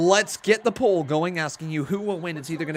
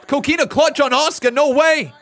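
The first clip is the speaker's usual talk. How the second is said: excited, louder, higher ringside calls; live play-by-play; 10 semitones higher, 9 dB louder than usual. Acted. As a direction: call the match as it happens.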